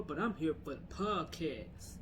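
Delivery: usual speaking voice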